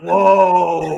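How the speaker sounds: drawn-out mock whoa; mock awed crowd. Host mimics excited fans hearing the trailer, exaggerated awe. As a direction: imitate a whole audience being impressed. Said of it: "everyone goes like".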